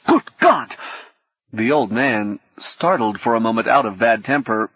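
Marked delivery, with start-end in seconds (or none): 0.1-0.7 alarmed exclamation. A narrator's reading. Hacker shocked at names.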